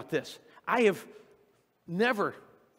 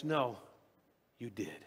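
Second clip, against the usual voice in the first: tender God; 7 semitones lower, 9 dB quieter than usual. Retold or imitated. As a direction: reassure her quietly, like a loving father. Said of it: "And God comes back and goes".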